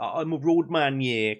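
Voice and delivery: roadman. Acted. Streamer showing chat what chavs became, mock roadman. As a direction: put on a roadman's voice. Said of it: "what's called roadmen now".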